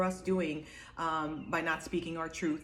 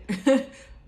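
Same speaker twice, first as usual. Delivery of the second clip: loud laugh; 8 semitones higher, 10 dB louder than usual. Real, not acted. Laughs at breath-holding.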